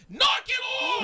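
very high, loud yell; boy yelling at his penis; mock yell. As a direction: shout it like a furious kid. Shouting at penis.

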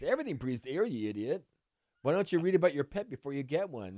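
imitating an irritated know-it-all telling someone off; retold exasperated scolding. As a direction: scold a clueless pet owner. Store man berates pet owner.

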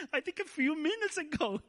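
high, laughing punchline. Real, not acted. Preacher laughing at his surfing claim. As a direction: laugh through the confession.

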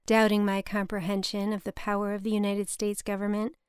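ordinary delivery

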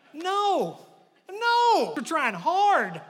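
high, loud protesting cry of the failing prophets; frantic prophets. Pretend. Prophets of Baal answering Elijah's mockery, high protest. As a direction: whine that you're trying hard.

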